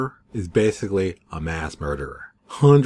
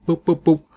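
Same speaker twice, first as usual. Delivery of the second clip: mock dropping noise; 7 semitones higher, 5 dB louder than usual. Money dropping sound effect.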